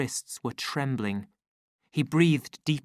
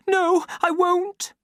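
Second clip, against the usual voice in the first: scared refusal; 5 dB louder, slower than usual. A narrator's reading. Lenina hiding, refusing.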